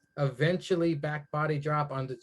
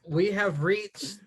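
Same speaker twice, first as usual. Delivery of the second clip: slow, deliberate main-event announcement; measured ring-announcer build-up; 3 semitones higher than usual. Pretend. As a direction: announce the big match with weight.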